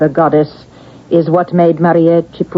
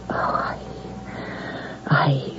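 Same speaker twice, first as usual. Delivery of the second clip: faltering whisper; 2 semitones lower, 14 dB quieter than usual. Acted. Emotional confession, breaks to whisper.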